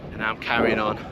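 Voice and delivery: ordinary talk, nothing marked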